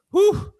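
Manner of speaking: loud, high whew of relief. Real, not acted. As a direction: blow out a big exhale. Exhausted by the news rush.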